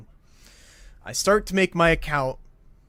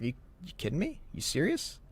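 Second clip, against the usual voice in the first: stunned disbelief; 10 dB quieter, faster than usual. Retold or imitated. Told no tolls owed.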